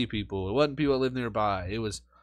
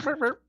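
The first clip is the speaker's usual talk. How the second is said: mock burp noises. Shown as a demonstration. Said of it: "Burp talk".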